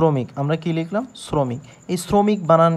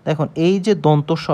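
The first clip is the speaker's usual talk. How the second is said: ordinary talk, nothing marked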